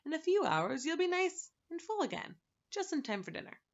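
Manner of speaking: high, kindly reassurance; sympathetic. Acted. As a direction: cheer him up gently. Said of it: "from his most sympathetic face".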